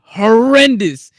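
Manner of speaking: drawn out, loud and high "horrendous"; emphatic. Real, not acted. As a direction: stretch "horrendous" slowly and loudly. Ranting about USA team.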